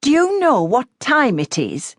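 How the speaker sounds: annoyed voice through a door; irritated woman. A narrator's reading. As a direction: call out, irritated and muffled. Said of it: "An irritated woman's muffled voice".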